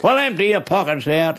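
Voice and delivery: high, bossy command; homeowner ordering. Retold or imitated. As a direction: order him to turn out his pockets. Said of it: "homeowner orders".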